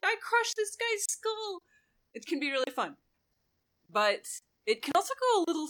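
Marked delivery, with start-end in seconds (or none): 0.0-1.6 high-pitched slow barbarian voice; falsetto barbarian. Acted. Barbarian bragging to the table, in slow high falsetto. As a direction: boast like a barbarian, but in falsetto. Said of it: "you're like a barbarian".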